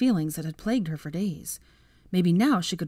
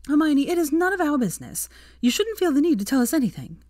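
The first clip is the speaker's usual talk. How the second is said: higher, louder voice for a supportive friend; protective female friend; 10 semitones higher, 5 dB louder than usual. Acted. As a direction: jump in high and emphatic on her behalf. Voices Tracy addressing Hermione.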